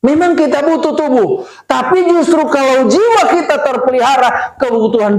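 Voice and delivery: high, emphatic sermon delivery; forceful preaching. Real, not acted. Preacher quoting scripture to the congregation, high and forceful. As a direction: drive the verse home to the congregation.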